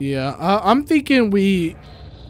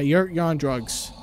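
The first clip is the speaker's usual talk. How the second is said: ordinary talk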